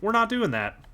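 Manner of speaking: high, emphatic parental refusal; a touch of mock-stern dad; firm dad voice. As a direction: lay down the law with the daughter. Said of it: "I was like".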